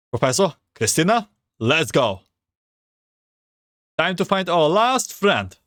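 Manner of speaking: ordinary talk, nothing marked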